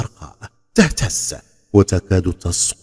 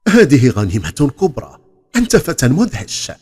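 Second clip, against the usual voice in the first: loud, higher cry of admiration; admiring exclamation; 5 semitones higher, 4 dB louder than usual. Acted. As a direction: exclaim in delight at the treasure. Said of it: "وصاح في إعجاب".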